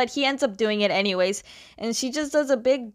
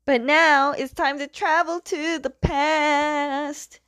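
high, drawn-out mock-dramatic announcer; dramatic flashback announcement; 6 semitones higher, slower than usual. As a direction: stretch it out high like a time-travel intro.

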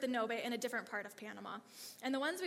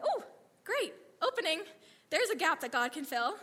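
excited; 5 semitones higher, 6 dB louder than usual. Retold or imitated. Excited at a healing opportunity.

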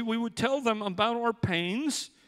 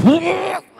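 a sudden high, loud laugh at himself; burst of laughter; 10 semitones higher, 10 dB louder than usual. Real, not acted. Laugh after saying okay.

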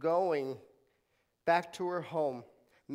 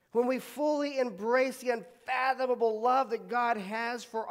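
high, loud, building preaching; fervent preaching; 7 semitones higher, 6 dB louder than usual. Acted. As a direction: drive the point home to the crowd. Preaching at full force.

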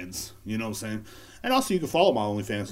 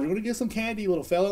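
sweet promise; 10 semitones higher, faster than usual. Pretend. Teasing a child with candy.

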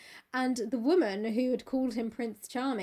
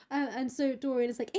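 ordinary talk, nothing marked

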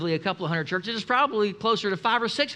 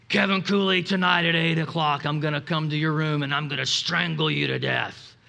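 mild demonic voice; 6 semitones lower than usual. Retold or imitated. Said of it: "he's speaking in this demonic voice".

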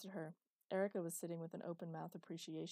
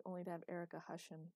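usual speaking voice

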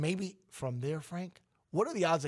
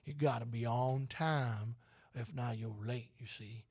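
southern twang; 4 semitones lower, 5 dB quieter than usual. Pretend. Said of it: "Have I worked well on the voice yet".

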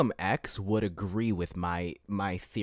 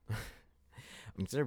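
laughing apology; 5 dB quieter, much slower than usual. Real, not acted. Laughs mid-sentence, apologizes.

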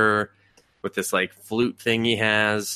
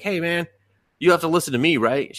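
put-on casual demon girl; 8 semitones higher than usual. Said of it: "she's just like".

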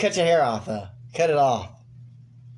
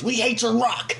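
higher, mock jeering fan; angry crowd; 7 semitones higher than usual. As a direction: jeer like a fed-up fan. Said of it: "What if they just dragged him off".

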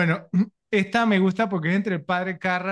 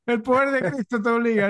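mock exorcist; 6 semitones higher than usual. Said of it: "entonces empezaba".

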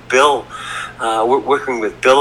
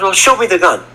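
higher-pitched mock demand; retold challenge, pitched up; demanding mother; 8 semitones higher than usual. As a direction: imitate a mother insisting on proof. Voicing mother demanding proof.

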